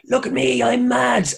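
high mock manic shout; mock-crazed comedian bit; mad Irish comic. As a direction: play the wild comic yelling at the crowd. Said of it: "the other kind of Irish comedy is like".

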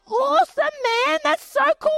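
very high, gushing approval; hammed-up excitement; thrilled reply. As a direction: exclaim it high and delighted.

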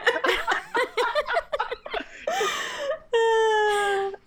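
long, high, breathless laughing fit; helpless laughter. Real, not acted. Co-host cracking up at their own troll voice, high helpless laughing. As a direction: crack up completely.

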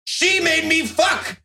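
very high, slow hysterical accusation; shrill mob confession; accusing townsman. Retold or imitated. Townsman blaming witch.

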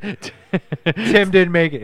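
slow, loud line through laughter; cracking up mid-line; laughing. Real, not acted. Laughing replay.

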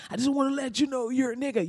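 high, strained but soft mock yell. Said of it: "he gets mad".

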